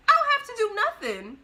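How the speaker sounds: high, loud sassy comeback; defiant retort. Retold or imitated. Narrator refusing to move for the old lady, high and loud.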